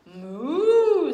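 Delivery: loud, high mock moo; hammed-up cow noise. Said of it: "said Cow".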